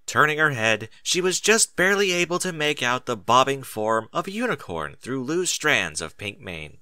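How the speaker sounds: ordinary delivery, nothing marked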